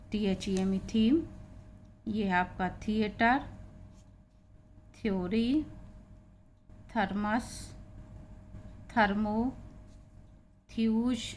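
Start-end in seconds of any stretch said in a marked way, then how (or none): 0.9-1.2 थीम with aspirated "th" before long "ee"; th demonstration. Shown as a demonstration. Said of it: "उसका उच्चारण हो रहा है". 5.0-11.2 थ्योरी, थर्मस, थर्मो, थ्यूज each starting on aspirated "th"; word-list reading of थ्योरी, थर्मस, थर्मो, थ्यूज with pauses between; th demonstration. Shown as a demonstration. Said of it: "उसका उच्चारण हो रहा है था".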